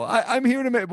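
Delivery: high innocent earnest voice; chirpy naive little character; sweet naive voice. Pretend. Voicing penis as sweet person.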